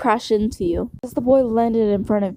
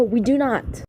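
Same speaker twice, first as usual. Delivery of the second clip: ordinary delivery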